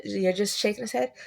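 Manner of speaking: ordinary talk, nothing marked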